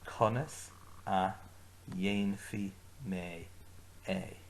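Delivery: conas a, dhéanfaí mé, é said slowly in chunks; slow broken-up phrase. Shown as a demonstration. Teacher breaks conas a dhéanfaí mé é into slow chunks. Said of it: "Agus arís".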